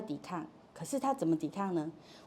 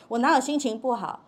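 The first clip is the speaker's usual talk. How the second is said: acted protest, louder and higher; loud denial; 4 semitones higher, 6 dB louder than usual. Child challenged about a bad mood, loud denial. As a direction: raise the voice to deny it. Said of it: "这么大声说他是心情不好吗".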